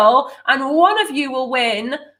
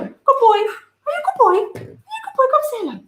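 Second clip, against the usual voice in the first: dog voice; 14 semitones higher, faster than usual. Real, not acted. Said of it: "Go dog voice. Got to have dog voice".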